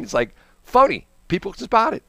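ordinary talk

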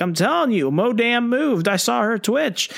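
a spooked henchman swearing he saw the corpse move; panicked henchman. Retold or imitated. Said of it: "they're all freaking out".

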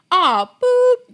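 high, loud laughing boop; mock censor beep; self-beep. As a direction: imitate a kid bleeping himself. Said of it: "he would beep himself".

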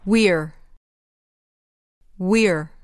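"We're" as one syllable, wir; contracted form. Shown as a demonstration. Teacher asks for the short form, then "We're" in one syllable. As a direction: squeeze "We're" into a single beat. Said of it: "به صورت فشرده بگید ما هستیم".

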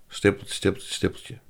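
fluisteren, zacht wegstervend; gefluisterde puntjes. Shown as a demonstration. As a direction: fluister de stippeltjes als een leeg vak. Blanks left in a quoted statement. (de puntjes zacht fluisterend ingevuld)

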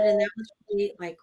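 normal talking voice

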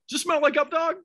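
high-pitched fake-innocent insistence; doubling down. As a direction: press the prank question, playing it straight. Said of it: "doubled down".

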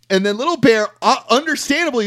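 ordinary talk

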